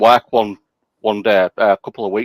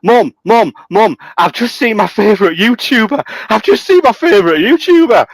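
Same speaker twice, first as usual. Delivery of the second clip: put-on a young kid yelling excitedly on a bus; excited kid shouting; 10 semitones higher than usual. Little boy on the bus shouting to his mum, high and excited. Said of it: "he starts shouting".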